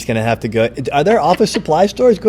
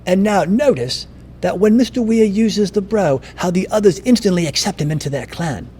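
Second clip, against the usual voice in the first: higher-pitched hushed nature-documentary narrator; David Attenborough; 4 semitones higher than usual. Retold or imitated. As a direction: narrate it like a wildlife film voice-over. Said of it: "David Attenborough use his voice".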